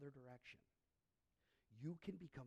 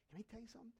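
ordinary talk

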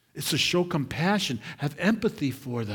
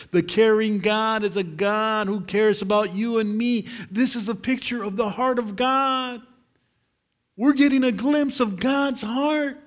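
higher and louder, ringing out the verse; full-force preaching; 8 semitones higher, 7 dB louder than usual. Acted. Preacher proclaiming Romans twelve to the church, full-force preaching. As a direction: preach it to the back row.

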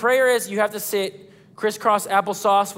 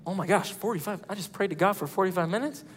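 quiet amazed aside; 3 semitones lower, 8 dB quieter, faster than usual. Retold or imitated. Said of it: "I'm like".

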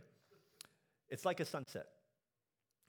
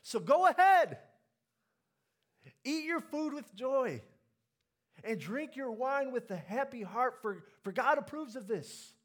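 high, loud, ringing declaration of permission; joyful proclamation; 10 semitones higher, 11 dB louder than usual. Retold or imitated. Pastor voicing the teacher to the congregation, loud and joyful. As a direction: proclaim it like glad news from the pulpit. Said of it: "and he says".

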